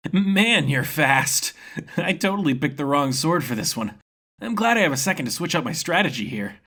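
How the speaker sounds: a carefree fighter enjoying the duel; grinning swordsman impressed by his foe; cheerful young fighter. Acted. Ren mid-fight, cheerful.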